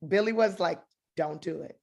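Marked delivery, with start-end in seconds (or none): 1.2-1.8 soft, low, measured instruction; retold calm direction. Director telling actress to stop hiding tears, retold quietly. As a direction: settle them with a hushed, spaced-out command.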